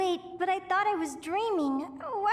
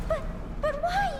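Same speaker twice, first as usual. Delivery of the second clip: higher, frightened question; rising panic, voice climbs; panicked; 11 semitones higher than usual. Acted. Panicked at shipwreck scene.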